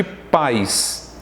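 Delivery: pais as one syllable, PAIS; one-syllable diphthong. Shown as a demonstration. Teacher contrasts it with país, pais in one syllable. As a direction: glide from A into I in pais, unbroken. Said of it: "pais é dito da mesma pronúncia".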